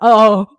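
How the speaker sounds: sudden loud mock groan; faked distressed cry. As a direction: burst out mid-sentence as if stricken. Faking sudden illness on a call.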